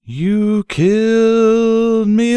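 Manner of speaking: ordinary delivery, nothing marked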